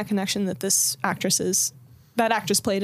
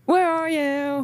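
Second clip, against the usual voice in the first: drawn-out call; 6 semitones higher, slower than usual. Retold or imitated. Imitating the call.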